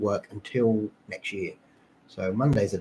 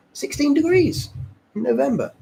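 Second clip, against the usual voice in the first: amazed. Real, not acted. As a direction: marvel at it. Amazed at November warmth.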